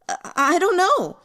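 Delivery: high, loud stammering fear; frightened cry. A narrator's reading. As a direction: stammer it out, terrified of the predator. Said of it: "Su Huai cried".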